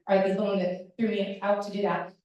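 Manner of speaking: normal talking voice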